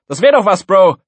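high, loud teasing; mocking taunt. A narrator's reading. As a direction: rub it in to a classmate. Pupil mocking his classmate.

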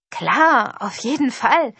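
loud, beaming yes with swooping pitch; gleeful, eager exclamation; overjoyed call. A narrator's reading. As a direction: call out delightedly, lifting the pitch. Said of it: "rief Damien freudestrahlend".

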